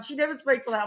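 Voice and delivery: ordinary talk, nothing marked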